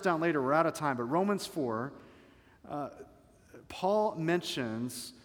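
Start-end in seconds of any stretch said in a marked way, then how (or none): none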